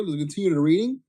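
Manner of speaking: ordinary delivery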